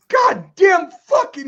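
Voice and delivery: high, loud, slow curse; rant. Retold or imitated. Janitor muttering curses at the mess, high loud disgust. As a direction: drag out the disgusted cursing. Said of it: "just going on a rant".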